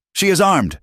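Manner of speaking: high alarmed shout. A narrator's reading. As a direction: yell it in alarm. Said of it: "Crowley shouted".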